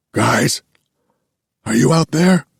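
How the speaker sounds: shrill desperate call. Acted. Philip calling from his hospital cot, shrill and desperate. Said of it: "His voice is shrill".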